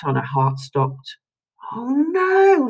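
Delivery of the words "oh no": breathless high whispered dismay; gasped oh no. A narrator's reading. Lucy seeing the news, a shocked breathless whisper. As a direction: gasp it in shock. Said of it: "Lucy cried".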